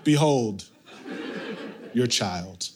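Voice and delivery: grand, deadpan announcement, dropping low at the end; mock-solemn presentation. Exhausted dad handing crying baby to mother, mock-solemn. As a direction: present it solemnly, with weary pauses.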